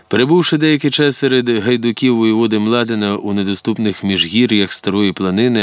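plain reading voice

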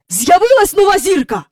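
high, loud announcement; excited calling. A narrator's reading. Mister Banks telling his children the news, excited calling. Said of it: "гукнув він".

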